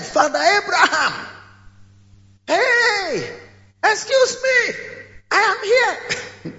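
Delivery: high desperate shout across the gulf; shouted call. Acted. Rich man shouting across the gulf to Abraham, high and desperate. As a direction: yell to be noticed, pleading. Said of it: "So he shouted".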